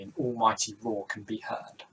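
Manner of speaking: normal talking voice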